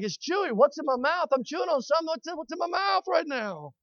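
high, hushed bewildered questions; puzzled chewing. Retold or imitated. Preacher reliving chewing mystery in milkshake, puzzled and hushed. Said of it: "I'm like".

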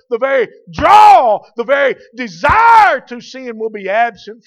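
loud, high, exultant pulpit shouting; fervent preaching. Real, not acted. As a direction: build to a hallelujah shout. Preaches at full force.

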